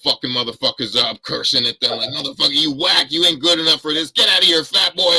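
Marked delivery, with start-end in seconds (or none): 2.1-5.1 barking, louder and higher; drill sergeant. Retold or imitated. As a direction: yell like a furious drill instructor. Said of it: "he just is like a drill sergeant".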